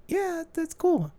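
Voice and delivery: high, slow casual yeah; high casual approval. Retold or imitated. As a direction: drawl it high and easy. Voicing own reaction.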